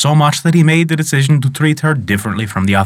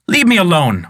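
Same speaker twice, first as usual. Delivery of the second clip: harsh; 6 semitones higher than usual. A narrator's reading. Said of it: "harshly answered the girl".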